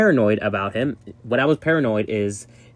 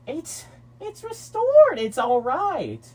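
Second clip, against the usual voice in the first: high excited; 13 semitones higher, much slower than usual. Real, not acted. Toy siren restored, excited.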